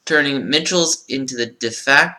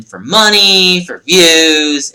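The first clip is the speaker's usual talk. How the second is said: loud, higher, drawn-out MONEY and VIEWS; scornful listing; 4 semitones higher, 7 dB louder, much slower than usual. Real, not acted. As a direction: stretch each motive out with pointed disdain. Scornful list of milking motives.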